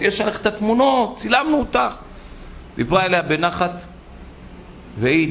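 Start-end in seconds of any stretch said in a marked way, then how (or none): 0.0-1.9 high-pitched accusing shout; retold shouting. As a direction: wave the photos at her. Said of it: "נתנה צעקות".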